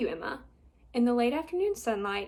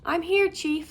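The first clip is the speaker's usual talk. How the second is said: loud high call; 7 semitones higher, 6 dB louder than usual. Acted. Boy answering fire chief, loud call. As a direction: call back through the hole. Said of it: "Stanley called".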